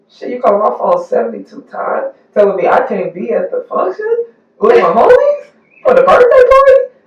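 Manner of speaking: high-pitched incredulous rant; mock indignant complaint. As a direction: climb high in outraged disbelief. Mimics a controlling partner's phone complaint.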